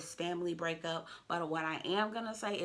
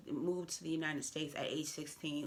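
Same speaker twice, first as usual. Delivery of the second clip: ordinary talk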